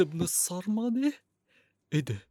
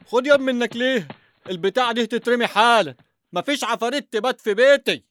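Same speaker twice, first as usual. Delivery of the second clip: angry shouting; 4 semitones higher, 5 dB louder than usual. Acted. Father orders doll thrown out.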